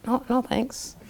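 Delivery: soft, deflated no thanks; a mumbled, half-hearted no thanks; quiet polite refusal. Retold or imitated. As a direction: turn her down gently and quietly. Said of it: "And I was like".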